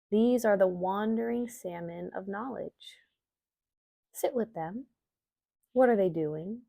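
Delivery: calm, measured meditation reading with long pauses; guided meditation. Acted. Yoga teacher guiding listeners through an Imbolc visualization, meditation voice. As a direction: guide listeners gently inward, leaving space between phrases.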